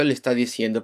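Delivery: ordinary talk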